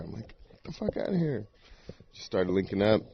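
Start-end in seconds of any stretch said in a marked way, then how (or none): 0.5-1.5 high, soft mock amazement; pitched way up, quietly incredulous; retold disbelief. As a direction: act out not believing your friend. Retold disbelief at friend's answer.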